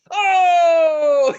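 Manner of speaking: very loud, high drawn-out "oh"; mock crowd reaction, shouted; rowdy whoop. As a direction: whoop like a team hearing something outrageous. Imagined team reacting to edgy call.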